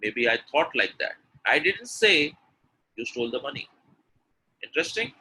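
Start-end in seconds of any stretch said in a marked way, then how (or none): 1.5-3.7 emphatic "say" before a pause; stress on say. Shown as a demonstration. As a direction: hit "say" then drop away. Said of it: "when I'm stressing on say".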